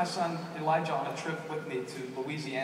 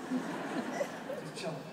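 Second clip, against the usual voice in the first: "Just chilling" stretched very slowly and higher; drawn-out laid-back; 7 semitones higher, 6 dB quieter, much slower than usual. Shown as a demonstration. Pastor describing his calm son, "Just chilling" drawn out slowly. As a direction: savour "Just chilling" like a kid without a care. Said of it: "He was just chilling".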